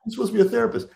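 indignant. Retold or imitated. Client protesting.

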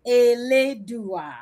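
Eledua said slowly as e-le-du-a; dictionary pronunciation. Shown as a demonstration. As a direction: say Eledua slowly, one syllable at a time. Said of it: "Break it into syllables".

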